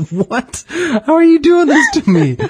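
high, loud mock outrage, voice cracking up; mock outraged Maverick. Maverick cries out at the rich guy's wallet in mock outrage. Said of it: "And Maverick is like".

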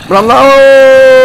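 high, slow alarmed yell; panicked cry. Real, not acted. Panicking at low health.

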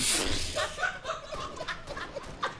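high, wheezy laugh; helpless real wheezing; breathless laughing fit. Real, not acted. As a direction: laugh until no air is left. Losing it with gum in mouth.

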